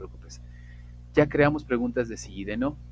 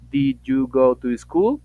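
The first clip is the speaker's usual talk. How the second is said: very slow, much louder English question; slow model question; 3 semitones higher, 11 dB louder, much slower than usual. Shown as a demonstration. Teacher showing the base question, very slow and loud. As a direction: drag out each word for the class. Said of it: "queda la estructura".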